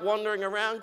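normal talking voice

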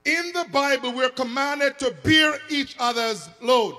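very loud, high, stretched-out proclamation; full-force preaching. Real, not acted. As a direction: proclaim it like a command from the pulpit.

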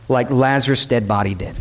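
ordinary talk, nothing marked

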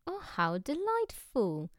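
high, slow, gleeful exclamation; delighted exclaim. A narrator's reading. Foreigner thrilled by their atheism, high slow delight. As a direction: savour each word with delight. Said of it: "exclaimed the astonishing foreigner".

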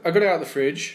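usual speaking voice

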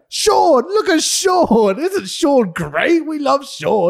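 loud, high, slow gushing over Sean; hammed-up fan-girl cheering; excited hype. Hyping video package mockery.

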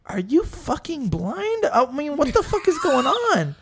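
high, stretched-out disbelieving question; mock incredulity. Retells his own incredulous thought.

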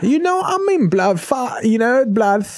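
high laughing mock London voice; mock British accent. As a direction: giggle through a squeaky cockney send-up. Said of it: "British accent with a lisp".

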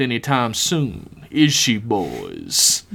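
slow, menacing villain drawing out the words; drawn-out, knowing threat; villain Billiams. Acted. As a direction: taunt slowly, calling on the henchmen. Villain Billiams addresses his henchmen.

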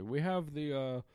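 loud, slow hyped ad voice; hyped announcer. Retold or imitated. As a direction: sell the sponsor loudly. Mock sponsor announcement.

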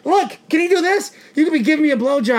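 loud, high-pitched mock challenge; mock taunting. Acting out a taunting confrontation.

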